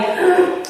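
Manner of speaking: high, sudden acted gasp; startled gasp. Sister realizing her blunder mid-amends, sharp startled gasp. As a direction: gasp as if caught out.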